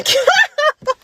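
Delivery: high, slow, breaking into helpless laughter; real giggle fit, way up high; laughing. Real, not acted. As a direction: crack up at own blunder. Laughs at own slip of tongue.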